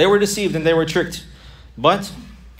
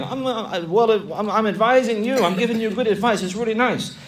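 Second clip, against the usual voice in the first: mock sweet devil; 6 semitones higher than usual. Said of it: "Just like shaitan is lying".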